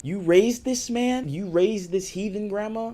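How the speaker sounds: high, slow, disbelieving; incredulous. Real, not acted. Narrator reacting to grandma raising the cop, high slow disbelief. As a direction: stretch it out in total disbelief.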